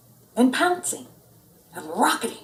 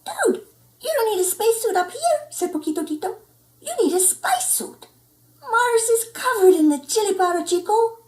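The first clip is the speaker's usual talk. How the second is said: much higher, breezy little dog; tiny chihuahua; 13 semitones higher than usual. Acted. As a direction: laugh off his silly suit. Poquito Tito, high.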